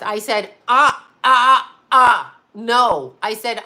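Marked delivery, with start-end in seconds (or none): 0.7-3.1 loud, halting interjections; cutting off. Retold or imitated. Client stops lawyer mid-talk, loudly objecting. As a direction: shut them down with loud staccato objections.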